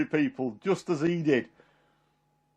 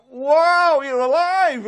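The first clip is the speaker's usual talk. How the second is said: high, loud, astonished wow; re-enacted joyful astonishment; amazed exclamation; 11 semitones higher, 6 dB louder, much slower than usual. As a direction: cry out in amazement. Retold amazement at resurrection.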